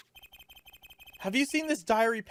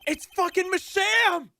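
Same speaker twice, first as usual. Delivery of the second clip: high, slow yell of disbelief; shocked yell; 14 semitones higher, 5 dB louder, slower than usual. Real, not acted. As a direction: shout it in stunned disbelief. Shocked at surprise witness.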